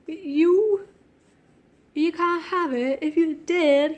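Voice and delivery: badly faked British accent, pushed hard; amateur mock-British voice. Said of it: "I can't do British accent".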